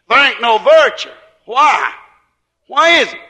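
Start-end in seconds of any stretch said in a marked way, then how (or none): none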